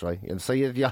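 usual speaking voice

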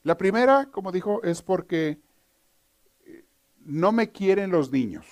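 put-on high, loud, slow complaining kid voice; whiny son. Joke son lists excuses to stay in bed, whining. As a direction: drag out the excuses like a pouting child.

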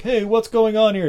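high, loud bystander call; put-on indignant passer-by; mock challenge. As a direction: call out like a guy intervening. Acted passers-by challenging the attacker.

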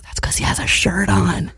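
conspiratorial whisper. Retold or imitated. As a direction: whisper it behind your hand. Pointing out Rake Boy at dinner.

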